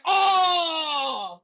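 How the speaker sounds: high, drawn-out "oh" of impact; hammed-up wail of pain; mock pained cry. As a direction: cry out as if hit in the stomach. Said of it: "sledgehammer to the stomach".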